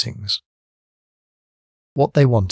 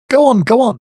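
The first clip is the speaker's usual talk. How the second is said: much higher, louder crowd shout; 6 dB louder than usual. A narrator's reading. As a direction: urge him on loudly. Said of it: "shouted the crowd".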